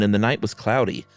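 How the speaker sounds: ordinary delivery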